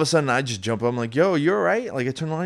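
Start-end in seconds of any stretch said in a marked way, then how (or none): 1.2-1.9 high, alarmed call across the room; jolted awake and checking on him; startled check-in. Retold or imitated. Jumping up to check on his friend, high and alarmed.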